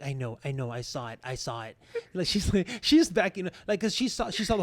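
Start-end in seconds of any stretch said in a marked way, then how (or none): 0.0-1.8 quiet reassurance. Retold or imitated.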